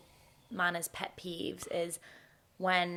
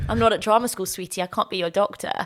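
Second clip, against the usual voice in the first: louder, sassy throwback; teasing her partner with his own line; mocking retort; 9 dB louder than usual. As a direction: throw his own words back at him. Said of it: "I'd be like".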